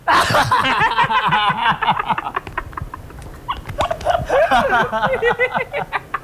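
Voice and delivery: a long squealing laugh, way up high; can't stop laughing at the silly story. Real, not acted. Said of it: "Cười gì cười hoài vậy".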